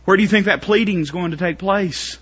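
high, loud shouted preaching; preaching at full force. Acted. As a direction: drive it home shouting.

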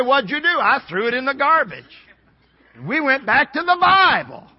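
high, loud pulpit punchline; loud, high preacher's cry; full-force preaching. Real, not acted. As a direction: ring it out to the congregation.

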